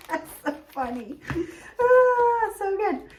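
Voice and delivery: high laugh then laughing words; laughing about husband taking credit. Real, not acted. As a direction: giggle through it.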